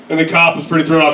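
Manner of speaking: normal talking voice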